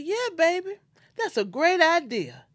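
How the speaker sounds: a lively mother's quick approval; cheerful mother. Retold or imitated. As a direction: play the mother eagerly saying yes. Mother cheerfully agrees to recording.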